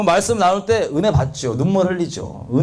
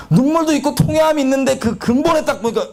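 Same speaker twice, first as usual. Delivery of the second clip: rising fervor; 7 semitones higher than usual. Acted. Preacher rising to a climax.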